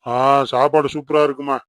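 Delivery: ordinary talk, nothing marked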